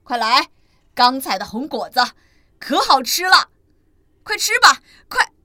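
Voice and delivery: a happy dad with fresh fruit; eager dinosaur dad. Acted. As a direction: urge the kids to come eat. Dad calls babies to eat.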